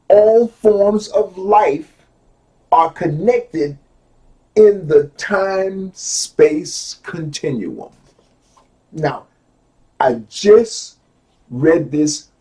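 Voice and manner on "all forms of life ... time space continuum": very slow, loud quotation; deliberate, declaimed reading; slow emphatic reading. Real, not acted. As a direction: space out each phrase with weight. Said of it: "take my time and go slow".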